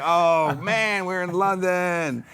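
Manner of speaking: high, slow dreamy amazement; hammed-up tourist wonder; mock awe. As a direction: marvel at it, stretching the words. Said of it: "and we were like".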